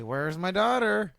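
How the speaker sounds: mock worried mom. Retelling the mother asking for her daughter, hammed up and high. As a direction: plead it high and drawn out like a frantic mom. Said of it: "The mom was like".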